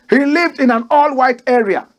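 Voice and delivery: ordinary delivery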